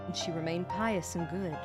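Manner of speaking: ordinary delivery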